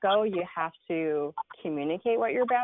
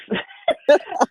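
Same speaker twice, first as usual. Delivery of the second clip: burst of laughter; 10 semitones higher, 9 dB louder than usual. Real, not acted. Laughing at her own story.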